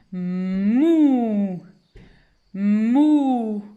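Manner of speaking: "mou" as a loud, drawn-out "mmmouuu"; blended syllable. Shown as a demonstration. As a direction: hum the M of "mou" into a long vowel. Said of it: "Je commence par le son de la première lettre". (« mou » lié, très étiré)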